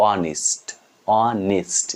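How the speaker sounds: অনেস্ট said as "o-nist"; careful অনেস্ট ending in "nist", not "nest"; i sound at end. Shown as a demonstration. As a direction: say অনেস্ট with a short i before st. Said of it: "ই উচ্চারণ লাস্টে".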